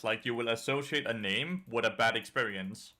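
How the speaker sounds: ordinary talk, nothing marked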